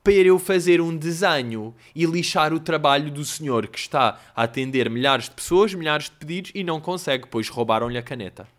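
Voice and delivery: self-mocking childish demand, growing loud; mock little boy. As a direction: start as a bratty kid, then build to loud mock outrage. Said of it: "senti mesmo um menino".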